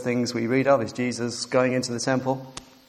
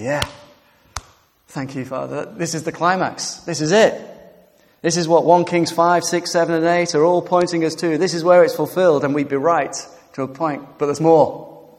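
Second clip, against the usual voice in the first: rising, louder, thrilled voice; excited; 5 semitones higher, 8 dB louder than usual. Pretend. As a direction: announce the climax with mounting excitement. Imagined congregation's excited response.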